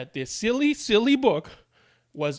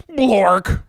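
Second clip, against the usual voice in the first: comic nonsense word, louder; silly nonsense word; 4 semitones higher, 7 dB louder than usual. Acted. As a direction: blurt out a silly made-up word. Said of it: "That doesn't even mean anything".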